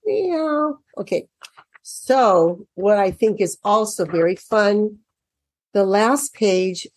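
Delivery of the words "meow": imitated meow. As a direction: mew like a little cat. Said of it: "that's just how they meow".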